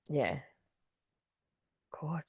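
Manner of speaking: breathy whispered God; whispered sigh. Real, not acted. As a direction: let it out in a whisper. Drained sigh on stream.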